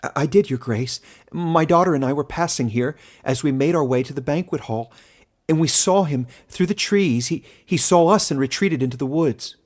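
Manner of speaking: a frightened woman answers the lord; woman's voice. A narrator's reading.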